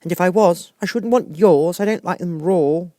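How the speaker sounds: put-on a truthful child reasoning; lightly high girl voice; lightly voiced little girl. A narrator's reading. Alice replies to pigeon.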